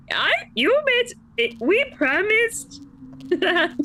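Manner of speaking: high, hammed-up impression of Brink; squeaky mock voice, drawn out; bad impression. Retelling Brink's bargain in a bad high voice. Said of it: "That was a bad impression".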